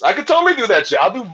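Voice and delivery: ordinary delivery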